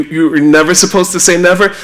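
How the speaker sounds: usual speaking voice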